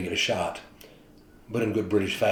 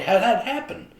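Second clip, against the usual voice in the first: pitched way up in disbelief; astonished question; 12 semitones higher, 3 dB louder than usual. Retold or imitated. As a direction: play the stunned reporter. Reporter stunned by Martin's news.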